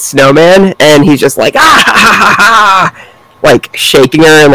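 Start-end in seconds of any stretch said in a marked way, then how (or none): 1.6-3.1 high, hammy villain cackle; evil laugh. Retold or imitated. Snowman attacking the girl.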